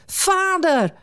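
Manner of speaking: high, ringing cry of Vader!; calling out. Acted. Son running to his father, high joyful cry. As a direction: call out to a father seen at last. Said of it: "riep hij".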